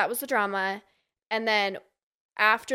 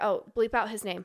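ordinary delivery

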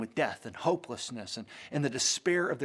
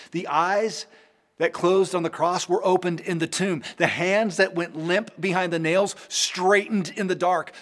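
louder, rising Easter proclamation; triumphant preaching; 5 semitones higher, 6 dB louder than usual. Acted. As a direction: build to a joyful climax.

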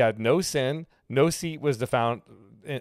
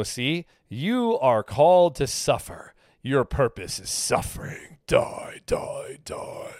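mock harsh preacher; slower than usual. Mocking harsh pulpit preachers who say suffering is your purpose. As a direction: send up a grim pulpit preacher who calls for dying.

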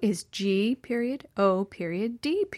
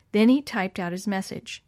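ordinary delivery

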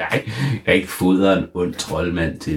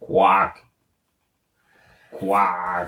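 high croaking toad noise; very high mock kvak; 13 semitones higher than usual. Wizard becomes toad.

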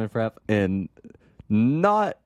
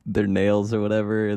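usual speaking voice

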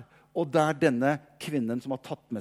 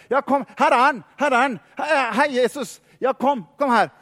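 higher and louder, waving people over; joyful shout to the townspeople; excited calling; 8 semitones higher, 7 dB louder than usual. Retold or imitated. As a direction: call the crowd round the corner, eager. Woman leads the crowd to Jesus.

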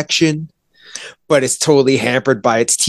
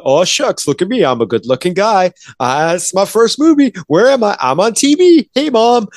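aw-shucks newcomer; 7 semitones higher than usual. Retold or imitated. Mocking Gere as a starstruck rookie, aw-shucks voice. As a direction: play the dazzled new actor, climbing higher. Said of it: "Gere kinda just looking like".

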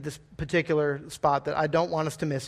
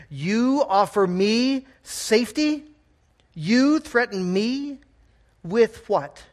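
incredulous dragon; 5 semitones higher, 4 dB louder, slower than usual. Acted. Dragon answers Ged in disbelief.

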